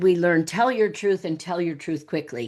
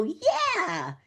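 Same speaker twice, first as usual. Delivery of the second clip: mock high yeah; 10 semitones higher, 3 dB quieter than usual. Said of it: "And I go".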